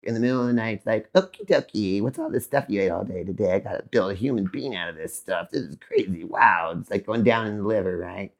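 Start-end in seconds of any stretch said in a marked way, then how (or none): 1.1-6.8 low, quiet worker's grumble; the body talking. Acted. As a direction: voice a busy body working away down low. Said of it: "and your body's like".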